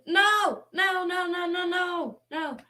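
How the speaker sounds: loud desperate no's; panicked shouting. Real, not acted. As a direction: yell at the frozen screen. Panicking as the stream cuts out.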